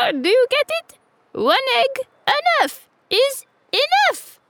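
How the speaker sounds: high rooster voice through laughter; laughing cockerel. A narrator's reading. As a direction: barely get the words out for laughing. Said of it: "falling about laughing".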